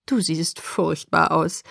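slow, amused teasing; grinning. A narrator's reading. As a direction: say it with a grin. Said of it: "teilte er mir grinsend mit".

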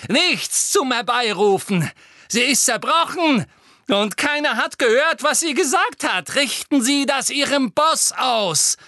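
high, loud, rising taunt; defiant shouting. A narrator's reading. Boy sends a message to her boss, defiant shout. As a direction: shout it across at your enemy. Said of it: "rief er".

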